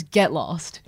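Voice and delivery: giggling through a mock dismissal; laughing. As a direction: laugh your way through the brush-off. Retold stranger brushing her off.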